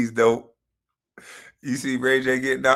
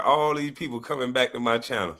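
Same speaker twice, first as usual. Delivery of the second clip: ordinary talk, nothing marked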